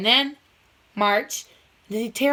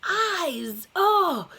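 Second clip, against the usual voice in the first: dazzled Toad; 8 semitones higher, much slower than usual. Acted. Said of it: "And Toad said".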